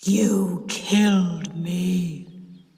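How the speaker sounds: very slow accusing ghost voice, stress on killed; slow, solemn ghost accusing the aunt; deep terrible voice. A narrator's reading. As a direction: drag out "killed" like a vengeful ghost. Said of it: "in a deep, terrible voice".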